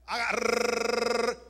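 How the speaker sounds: slow long trilled rrrr; rolled R. Shown as a demonstration. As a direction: roll the tongue on rrrr. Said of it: "a ver". (erre vibrante larga)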